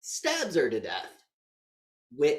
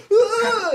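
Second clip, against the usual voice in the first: very high, loud mock shriek; panicked scream; 15 dB louder than usual. Man caught by the landlady, a shrill panicked yelp. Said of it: "otherwise you're".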